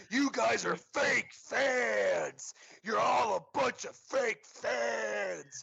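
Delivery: high, loud, slow heckle imitating a drunk fan; mimicked drunken stadium taunt; drunk fan yelling. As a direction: holler the accusation at a whole crowd, stretching the words. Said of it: "He just kept yelling it".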